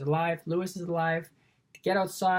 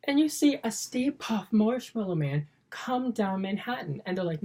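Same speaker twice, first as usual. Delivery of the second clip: ordinary delivery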